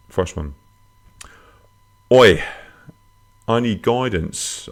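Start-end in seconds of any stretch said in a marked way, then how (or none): 2.1-4.7 high, loud Australian "Oi", then slower; Australian accent. Acted. Host reading a listener's plea for guidance, Australian accent. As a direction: read it out in a put-on Australian accent. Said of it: "I don't know why I'm doing Australian".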